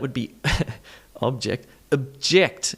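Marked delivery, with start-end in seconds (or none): 1.2-1.7 OB-ject, the noun; full O on "object", stressed first; first-syllable stress. Shown as a demonstration. Teacher laughs at the wrong take, OB-ject. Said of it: "not object". 1.9-2.7 uh-JECT, JECT punched; second-syllable stress. Shown as a demonstration. Teacher names the verb, stressing JECT in "Object". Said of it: "is the verb".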